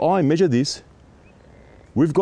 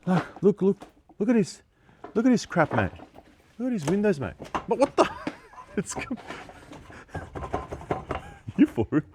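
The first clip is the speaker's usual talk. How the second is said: high, urgent calls to look; pointing out the mess in disbelief; excited disbelief; 9 semitones higher, 6 dB quieter, faster than usual. Real, not acted. Inspector urging builder's client to look, excited. Discovering shoddy building work.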